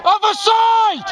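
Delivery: high slow drawn-out yell; shout. Real, not acted. Warning squad in battle.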